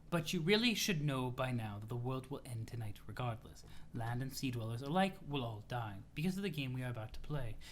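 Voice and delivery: low, soft, measured character voice; composed, solemn troll. Acted. Troll telling CA the world ends tonight, calm and solemn. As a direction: state the doom calmly, low and quiet.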